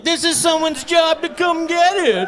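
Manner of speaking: high, slow snooty voice; mock entitled shopper. Lazy shopper excusing the abandoned cart, high snooty. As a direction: sneer it like a smug jerk. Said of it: "They're like".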